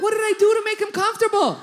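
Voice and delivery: high, loud, fast protest; aghast comic yelp; exasperated outburst. Retold or imitated. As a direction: cry out in hammed-up dismay. Retold aghast reply to exterminator.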